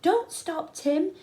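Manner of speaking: high, loud shout upward; calling up from below. A narrator's reading. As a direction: call up to a friend stuck on the rock. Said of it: "Biscuits called from underneath me".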